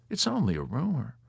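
soft, slightly high self-importance; self-important. A narrator's reading. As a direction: point it out loftily. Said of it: "with a self-important air".

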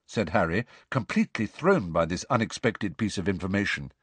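ordinary delivery

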